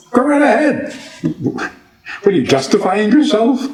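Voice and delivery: high-pitched comic challenge; mock incredulous. Teacher teasing God for announcing creation, mock incredulous.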